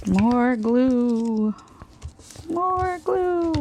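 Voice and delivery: eerie high ghostly moan; drawn-out spooky wail; haunting voice. Pretend. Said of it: "I sound like I'm haunting myself".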